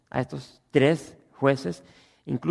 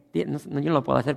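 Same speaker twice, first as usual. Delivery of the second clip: ordinary talk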